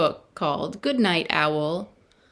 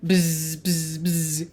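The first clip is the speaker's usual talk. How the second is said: long voiced bee buzz; much slower than usual. A narrator's reading. Bees keeping Owl awake, drawn-out buzzing. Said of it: "the bees buzzed".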